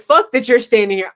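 ordinary talk

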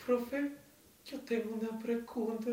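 high, sing-song imitation of the classmate; mock classmate. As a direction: imitate her asking the teacher. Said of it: "esta man dice". (imitación aguda de la compañera preguntando al profe)

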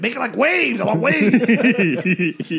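high, loud, drawn-out mock command; demanding yell. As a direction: holler it, stretching the last word. Drunk man demands waves in joke.

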